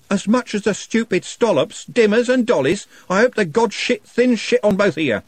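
high, loud cursing; furious curse. A narrator's reading. Trev cursing both gangs, furious. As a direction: curse them all.

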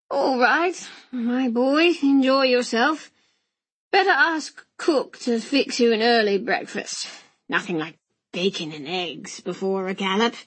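hearty uncle, lively rise then drop; hearty old uncle. Acted. Uncle sending his nephew to bed, hearty. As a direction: play an indulgent, blustery uncle.

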